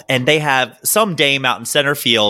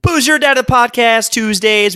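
announcer voice; 8 semitones higher than usual. Acted. Podcast intro jingle voice.

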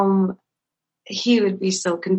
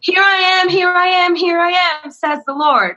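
mock prophetic yell; 7 semitones higher, 3 dB louder than usual. A lady prophesying to the congregation, mock yelling. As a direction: call it out like a prophet in church. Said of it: "just envision someone yelling".

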